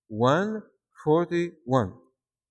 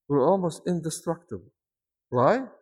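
ordinary talk, nothing marked

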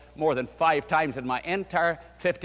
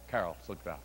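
much lower and softer, almost under the breath; hushed refrain; 12 semitones lower, 13 dB quieter than usual. A narrator's reading. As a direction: let it drop away as she leaves. Refrain repeated softly.

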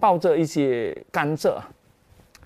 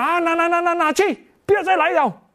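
high, scornful brush-off; contemptuous dismissal; 11 semitones higher than usual. Retold or imitated. Vendor tossing coins at old woman, scornful high voice. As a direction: shoo her away with disdain.

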